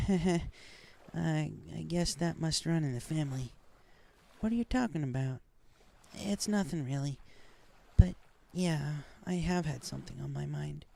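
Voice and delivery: lightly voiced young pony, grateful; Rainbow voice. A narrator's reading.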